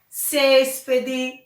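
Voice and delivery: céspede with a strong first syllable; stress on CÉS. Shown as a demonstration. Teacher shows where the accent falls, stressing the start of céspede. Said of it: "no ces".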